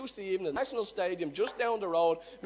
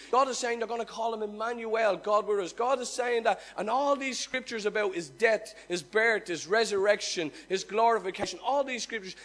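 louder, driving preacher voice; fervent preaching; 5 dB louder than usual. Acted. Preacher telling the congregation of God's silence, fervent and loud. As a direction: build the silence of God for them.

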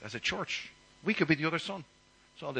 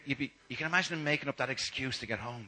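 normal talking voice

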